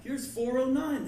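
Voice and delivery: high, loud call down the hall. Acted. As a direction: beckon a kid who has run ahead. Calls kid back to room.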